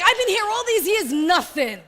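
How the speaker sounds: hard-done-by sibling protesting loudly; aggrieved grumble. Retold or imitated. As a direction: complain like the overlooked son, with a laugh. Older brother's grievance acted out.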